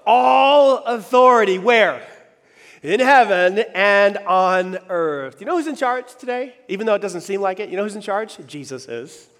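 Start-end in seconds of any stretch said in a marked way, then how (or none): 0.1-5.4 slow, loud call-and-response recital of scripture; slow emphatic recital. Shown as a demonstration. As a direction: draw out each word so the crowd says it with you. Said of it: "say it with me".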